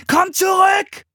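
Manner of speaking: loud, very high yell; desperate shout. A narrator's reading. Philipp yelling after his sister, shouting. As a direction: shout after someone running away. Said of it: "schrie Philipp ihr hinterher".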